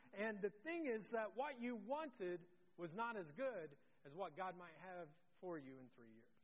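usual speaking voice

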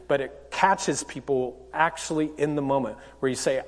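usual speaking voice

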